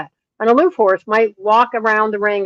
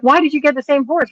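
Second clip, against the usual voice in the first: higher, insistent mock-incredulous question; retold exasperation; 7 semitones higher, 4 dB louder than usual. Trainer asking friend about the same horse, exasperated. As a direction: question her choice in disbelief.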